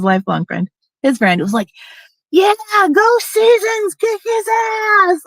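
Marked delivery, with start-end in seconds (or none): 2.3-5.2 imitated cheering. Friend cheering on a fight, imitated high whoop. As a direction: egg someone on like a rowdy spectator, without real volume. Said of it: "people were cheering".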